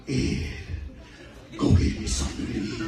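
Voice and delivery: bossy stomach voice, low then whispered; demanding stomach; stomach talking. Acted. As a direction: rasp out the order like a hungry belly. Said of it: "It used to be".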